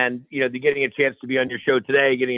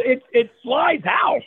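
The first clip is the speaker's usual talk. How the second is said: emphatic disbelief; 11 semitones higher, much slower than usual. Retold or imitated. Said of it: "I go".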